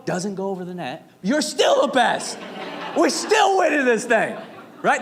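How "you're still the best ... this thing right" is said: high, loud sideline cheer; yelling cheer. Retold or imitated. Dad at volleyball game cheers his daughter's serve, loud yell. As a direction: cheer your kid on at full voice. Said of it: "And I'm yelling".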